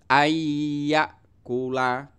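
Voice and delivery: ayyakula very slow, the y hummed with a nasal hold; teacher showing idgham bi gunnah; nasal merging. Shown as a demonstration. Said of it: "disertai dengan dengung".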